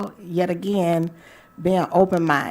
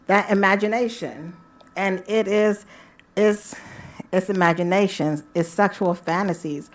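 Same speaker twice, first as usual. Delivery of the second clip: ordinary talk, nothing marked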